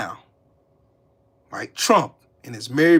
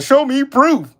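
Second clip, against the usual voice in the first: higher, louder whiny mock voice; mocking the commenter; 10 semitones higher, 6 dB louder than usual. Streamer mocks a chat viewer demanding proof, whiny mock voice. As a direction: sneer back the demand in a silly voice.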